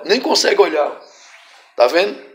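normal talking voice

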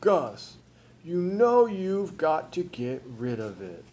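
slow, patient persuading; reasonable voice. A narrator's reading. Frizzle tells Gus to get rid of the baby, reasonably. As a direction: reason with him, stretching the words. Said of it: "He said in a reasonable voice".

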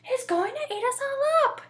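high-pitched mock scream. A narrator's reading. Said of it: "they all screamed".

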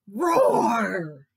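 loud, drawn-out falling roar; story-time tiger roar; little tiger roar. A narrator's reading. Tiger roars at monkeys.

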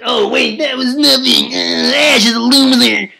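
squeaky high brat bragging; bratty Gary voice. Acted. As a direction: play the smug kid rival taunting. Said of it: "Gary is like".

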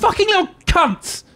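high, sharp swearing at the screen; angry outburst. Real, not acted. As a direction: spit it out in sudden frustration. Swearing at dinosaurs in game.

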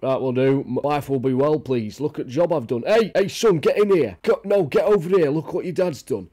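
a lightly voiced proud dad calling his son; mock proud father showing off the dog; proud owner voice.

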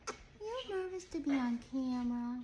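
ordinary delivery

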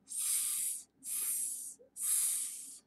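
long unvoiced S hiss; snake hiss sound. Shown as a demonstration. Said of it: "Let's make that sound".

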